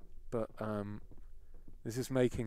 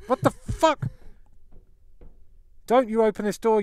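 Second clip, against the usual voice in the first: high, loud frightened shout; panicked yell; 10 semitones higher, 9 dB louder than usual. Real, not acted. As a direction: yell it at the door. Cornered in cupboard, yelling.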